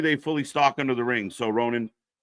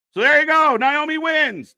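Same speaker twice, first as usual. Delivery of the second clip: high, held-out victory call; excited finish call; 13 semitones higher, 5 dB louder than usual. Real, not acted. Watch-along host calls Naomi's win, excited finish call. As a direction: announce the winner loudly, pitched right up.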